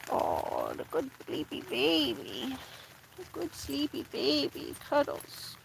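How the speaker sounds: very soft, slow cooing. Real, not acted. Cuddling her sleepy dog in bed, soft slow coo. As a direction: murmur tenderly to a sleepy pet.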